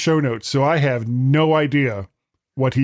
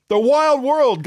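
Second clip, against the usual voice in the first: much higher, playful emphasis on wild; dramatic repeat; 10 semitones higher, slower than usual. Acted. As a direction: sing out wild like an announcer. Repeats with big rise.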